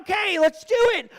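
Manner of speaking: high, loud, forced enthusiasm; fake bravado. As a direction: sound gung-ho while terrified inside. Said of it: "On the inside, I'm screaming".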